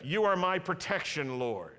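slow, loud, emphatic confession of faith; slow declaration. Shown as a demonstration. Preacher leading a call-and-response, slow and loud. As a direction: proclaim each word slowly to the congregation. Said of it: "Say that with me".

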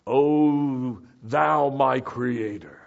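loud, grandly drawn-out mock prayer; very slow, lofty liturgical parody; exalted prayer English. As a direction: intone it with hammed-up solemnity. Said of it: "that kind of, you know, exalted English".

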